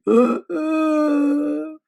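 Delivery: loud sobbing gasp; a big bear gulping between sobs; loud crying gasp; blubbering bear sob. A narrator's reading. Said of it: "the bear blubbers on".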